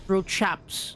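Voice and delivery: lightly put-on British accent; drawn-out, hint of hammy British lad; mock British. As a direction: play at being a British chap. Playfully praising Brits in British slang.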